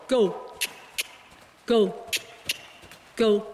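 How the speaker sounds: short punchy calls; brisk projected riding commands. Real, not acted. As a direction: urge them on with each word. Coach urging horse on.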